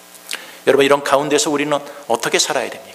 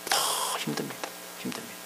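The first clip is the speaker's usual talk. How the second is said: hushed; 14 dB quieter than usual. Acted. Preacher hushes the answer.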